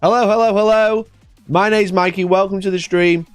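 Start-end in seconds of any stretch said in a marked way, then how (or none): none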